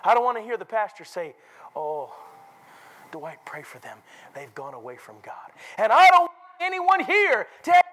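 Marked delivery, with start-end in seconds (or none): 1.8-5.5 low, hushed sorrow; grieving pastor. Acted. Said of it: "A cracking of the pastor's voice". 5.8-7.8 high, loud pulpit shout; sudden fiery exhortation; forceful preaching. Real, not acted.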